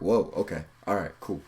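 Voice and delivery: low, backing-down acknowledgement; taken aback. Retold or imitated. Listeners reacting to the alpha's jab, subdued acceptance. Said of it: "wakes people up".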